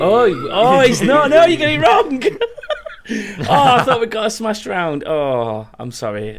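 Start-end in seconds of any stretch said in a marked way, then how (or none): 0.0-2.2 high, loud, startled protest; shocked the team got it wrong; surprised outburst. Real, not acted. Quizmaster hears wrong answer Skyrim. 2.2-5.0 laughing. Real, not acted. Quizmaster laughing after wrong answer.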